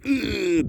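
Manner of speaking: high strained grunt, hammed-up; mock straining grunt. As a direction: squeeze out a silly pushing groan. Acted straining on the toilet.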